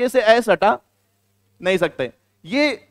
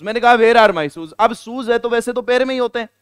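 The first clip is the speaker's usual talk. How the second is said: ordinary talk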